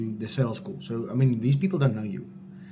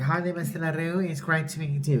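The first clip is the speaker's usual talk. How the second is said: flat bank-call greeting; 3 semitones higher, 5 dB quieter than usual. Shown as a demonstration. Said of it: "You can't have that kind of voice tone".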